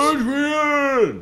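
hammed-up high, drawn-out call; Rocky. Quoting Rocky calling for Adrian, high drawn-out call. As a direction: stretch the name into a high mock yell.

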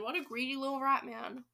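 ordinary delivery, nothing marked

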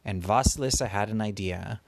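plain reading voice

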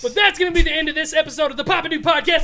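high, loud, fast hyped outro voice; hammed-up podcast host outro; announcer sign-off.

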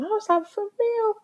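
high surprised squeak. Retold or imitated. Surprised reaction to friend.

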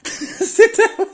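squeaked through laughter, very high; laughing order. Retold or imitated. Imitating his dad telling him to sit, laughing high.

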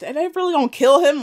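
high, loud mock panic; scared. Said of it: "I was scared".

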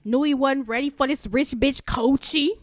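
loud, high rap-girl mimicry; extra stank. Host quoting a rapper's bars, extra stank. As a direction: hit the line with exaggerated stank. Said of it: "put all that extra stank on it".

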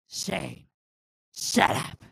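low, harsh boss silencing his son; Vince McMahon. Acted. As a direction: cut the kid off with a loud, low command. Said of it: "a nice little Vince McMahon impression".

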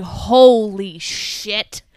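very slow, drawn-out awe; stunned holy shit. Retold or imitated. Retelling her reaction to co-host, slow stunned awe. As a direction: let it stretch in disbelief. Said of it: "And I was like".

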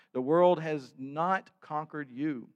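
ordinary talk

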